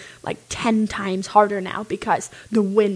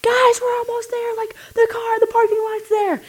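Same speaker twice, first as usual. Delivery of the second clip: yelling down; 14 semitones higher than usual. Retold or imitated. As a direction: shout it down the hill through the storm. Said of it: "And I yell down in this huge sandstorm".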